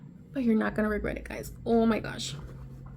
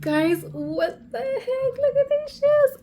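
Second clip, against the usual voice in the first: giddy laughing squeal, much higher at the end; real giddy laughter at the shoes; laughing excitedly; 13 semitones higher, 4 dB louder than usual. Real, not acted. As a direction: laugh through it in disbelief. Overwhelmed by the shoes.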